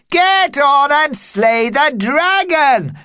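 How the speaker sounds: high slow rallying shout. Acted. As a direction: urge him on loudly. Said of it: "shouted the onlookers on the stage".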